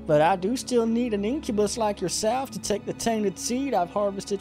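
high, theatrical female falsetto; villainess. Acted. Succubus telling Jack her plan, high villainess falsetto. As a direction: lay out the scheme in a put-on woman's voice.

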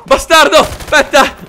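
loud, high outburst; alarmed yell. Real, not acted. Player cursing another attacking dog, alarmed yell.

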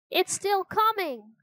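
high-pitched alarm, volume held in; mild shriek. A narrator's reading. Jack sees the bone following them, contained shriek. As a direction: raise the pitch in panic, storyteller-style. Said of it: "he shrieked".

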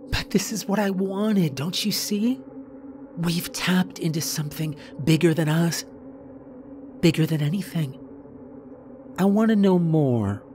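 fervent believer, building to a firmer finish; obsessed woman character. Acted. Voices obsessed Megan in dialogue.

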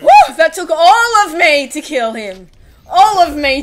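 very loud high whoop, then slow; victory whoop. Real, not acted. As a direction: cheer the win at full volume. Celebrating a hard kill.

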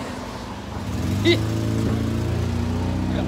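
long, soft wheezing laugh; breathy laugh. Real, not acted. Drunk friend amused by the wrecked car, soft breathy laugh. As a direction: laugh quietly, mostly on the breath.

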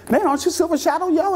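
high mock New York street voice; imitating New York accent. Two New York fans stopping him at the bus, mock New York accent. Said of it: "they had New York accents".

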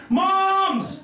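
put-on kid's high-pitched call to his mothers. Kid calls out to his moms, high-pitched yell. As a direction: yell for mom like a little kid.

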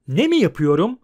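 loud, indignant echo question; shouting. A narrator's reading. Bard outraged at Geralt's question, shouting. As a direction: throw the question back loudly. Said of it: "diye bağırdı Ozan".

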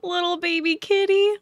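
high-pitched pet-talk coo. Real, not acted. Player to the baby kitty on screen, cooing baby talk. Cooing over the game's lion cub.

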